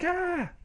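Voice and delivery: high-pitched mock yelp; acted pained shriek. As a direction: cry out as if suddenly scratched. Dreamer gets scratched.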